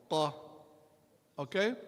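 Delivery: ordinary delivery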